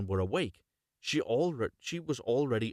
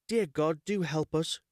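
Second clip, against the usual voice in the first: put-on crying girl; 6 semitones higher than usual. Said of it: "she cried".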